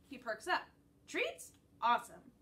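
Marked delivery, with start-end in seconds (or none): 1.1-2.3 high rising, loud perked-up yelp; eager surprise. A narrator's reading. Yellow lab hearing about treats, eager. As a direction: perk up at the word treats.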